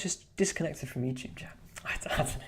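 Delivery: whispered aside, breath only. Real, not acted. Streamer whispering a chat notice to himself, under his breath. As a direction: murmur it to yourself, voice off.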